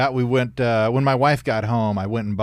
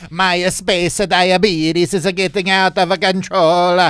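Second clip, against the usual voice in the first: slow, higher, louder alien voice; mock Italian alien; 7 semitones higher, 4 dB louder than usual. Co-host as Italian alien fretting about space diabetes.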